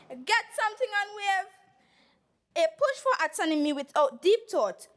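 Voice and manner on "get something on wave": lightly mimicked performer's call, pitched higher; Super Blue impression. Assembly member quotes a performer in debate, in a light imitation. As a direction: put on a raised-pitch imitation for a moment. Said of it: "In my best super blue voice".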